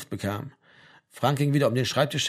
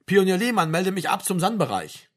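military report; 5 semitones higher than usual. Acted. Soldier signs off to his sergeant, formal military report. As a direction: report out like a drilled conscript.

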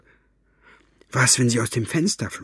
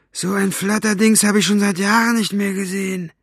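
high, raised villain's voice; rough henchman Basta, amazed; 10 semitones higher, 4 dB louder than usual. Acted. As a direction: marvel loudly as the henchman. Basta marvels at the fairy.

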